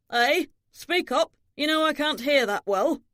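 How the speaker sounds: put-on hard-of-hearing old man; crotchety old father. Deaf old father demanding.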